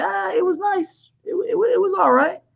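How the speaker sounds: mock unimpressed reply; nonchalant act. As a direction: play it cool, barely impressed. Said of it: "just be like".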